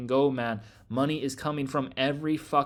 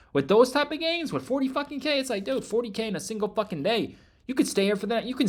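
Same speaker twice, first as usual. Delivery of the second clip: excited; 6 semitones higher than usual. Real, not acted. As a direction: pitch jumps much higher, hyped about the gains. Hyping up huge trading gains.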